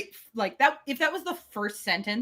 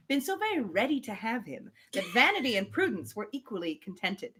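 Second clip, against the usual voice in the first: measured reading of a classic novel; story narration. Acted. Podcast host reading Emma aloud, measured narration. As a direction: read it like an audiobook narrator.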